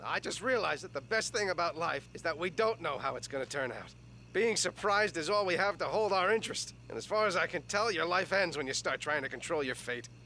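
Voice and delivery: low, measured heartfelt speech; earnest reflection. Acted. Man tells his other selves about facing the future, earnest reflection.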